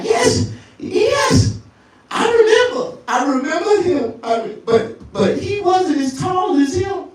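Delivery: high, shouting woman; girl's voice. Retold or imitated. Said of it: "She like".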